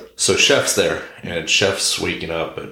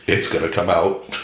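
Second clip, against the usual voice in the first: usual speaking voice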